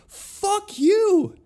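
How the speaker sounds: hammed-up furious yell at customer support; outraged. Retold rage at refused refund.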